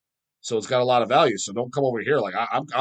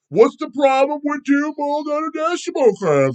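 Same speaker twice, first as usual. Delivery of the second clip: much higher, put-on whiny poser voice; mocking young poser; 13 semitones higher, 5 dB louder than usual. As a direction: ape a clueless nineteen-year-old fan. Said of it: "hanging out with this fucking".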